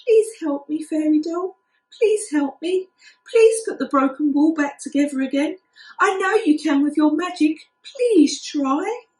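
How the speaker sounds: high, pleading little kitten voice. A narrator's reading. Kitten begging the fairy doll to mend the ball, pleading. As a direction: beg the fairy for help, voice lifted. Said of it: "mewed sadly".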